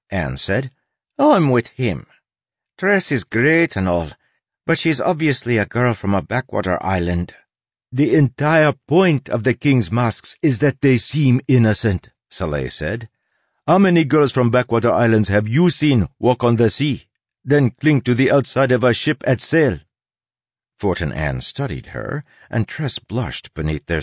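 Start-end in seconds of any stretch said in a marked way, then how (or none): 1.2-7.3 higher-pitched doubting crewmate; sceptical Anne. Acted. Anne doubts a backwater girl is a mask, sceptical. 7.9-12.1 higher, louder, put-on character voice; insistent Soleil. Soleil argues to the crew that Tress is a mask, insistent. 13.6-19.8 higher, louder, put-on character voice; insistent Soleil. Soleil argues to the crew that Tress is a mask, insistent.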